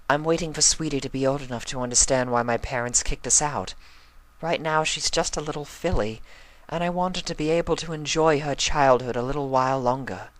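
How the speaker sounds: put-on an elegant mother protecting her child; Rarity's voice. A narrator's reading.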